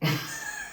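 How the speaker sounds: high evil movie-villain cackle; villain laugh. Acted. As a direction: cackle like a film baddie. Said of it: "J'ai l'impression d'être un méchant dans un film".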